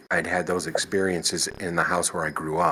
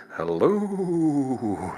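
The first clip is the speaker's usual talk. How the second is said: high, drawn-out ghostly hello; 8 semitones higher than usual. Retold or imitated. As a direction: call it out like a ghost. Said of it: "It would sound like a ghost".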